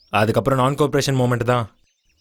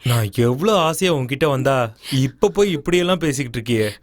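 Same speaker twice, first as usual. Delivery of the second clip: ordinary delivery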